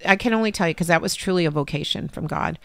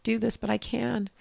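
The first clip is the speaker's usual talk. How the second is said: tearful thanks; 4 semitones higher, 8 dB quieter than usual. Retold or imitated. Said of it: "And I cried and cried".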